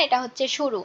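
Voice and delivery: ordinary talk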